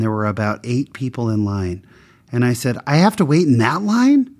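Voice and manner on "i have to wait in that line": incredulous, higher and louder question; can't believe he has to queue; shocked disbelief. Retold or imitated. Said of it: "I kinda surprised the guy".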